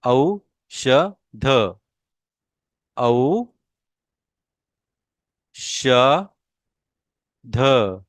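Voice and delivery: औषध stretched out very slowly, the औ drawn long; slow model pronunciation. Shown as a demonstration. Teacher models औषध for students to repeat, very slow. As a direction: spell औषध out slowly by sound. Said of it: "मेरे pronunciation के ऊपर आपने ध्यान देना है".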